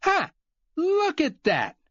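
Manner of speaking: high, slow mocking glee; fake delight. Glenn mocking Cooper for needing help, in high fake delight. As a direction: gloat with false cheer. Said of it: "cried Glenn with fake delight".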